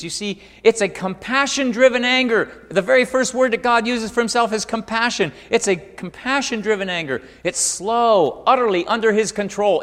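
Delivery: high, loud, emphatic preacher's voice; full-force preaching. Acted. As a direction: punch out each point to the congregation.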